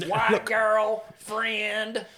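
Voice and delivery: very slow, drawn-out creature words; Belle's voice. Acted. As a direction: hold each word long and level. Voicing Belle's broken speech.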